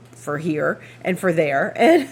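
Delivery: usual speaking voice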